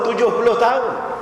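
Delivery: normal talking voice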